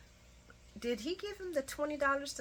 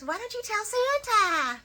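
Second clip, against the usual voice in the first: put-on much higher, childlike question; little girl Violet; 13 semitones higher, 5 dB louder than usual. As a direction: ask sweetly like a little girl. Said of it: "Violet says".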